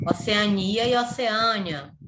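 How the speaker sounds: "Oceania" stressed on NI, then "Oceânia" stressed on Â; slow, careful contrast between "Oceania" and "Oceânia"; two pronunciations. Shown as a demonstration. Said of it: "têm dupla pronúncia". ("Oceania" com tônica em NI, depois "Oceânia" com tônica em Â)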